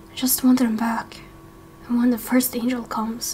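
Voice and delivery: whispered, broken confession; tearful whisper. Acted. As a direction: let it barely come out, grieving.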